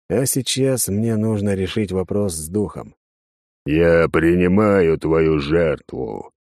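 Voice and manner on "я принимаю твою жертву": deep, slow, booming spirit voice; otherworldly booming voice. A narrator's reading. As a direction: drone it like a ghost from beyond. Spirit accepts sacrifice.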